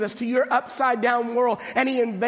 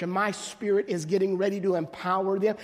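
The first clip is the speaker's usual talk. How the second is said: ordinary talk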